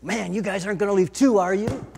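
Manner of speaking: loud, exasperated question to the disciples; frustrated. Retold or imitated. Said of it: "did he say it with frustration and anger?".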